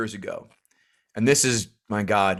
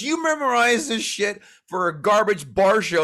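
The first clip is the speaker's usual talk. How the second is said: high-pitched mock scolding; retold indignant protest; imitated indignant wife; 7 semitones higher than usual. Acting out his outraged wife.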